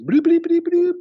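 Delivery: high, loud bleeping like a truck reversing; imitated bleeping noise. Podcast host imitates the cart guy's high bleeping noise. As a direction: beep it out in a high mock alarm. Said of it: "He makes funny noises".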